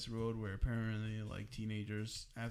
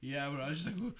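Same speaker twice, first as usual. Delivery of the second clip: ordinary delivery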